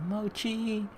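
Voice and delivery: a high call toward the door; calling the dog. Acted. Narrator calls out to the barking puppy, high call.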